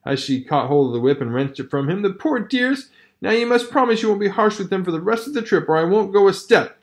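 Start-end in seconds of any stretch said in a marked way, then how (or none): 2.0-6.8 higher, fussy woman's voice; Mercedes pleading. Acted. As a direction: scold and protect the dogs. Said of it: "Mercedes interfered, crying".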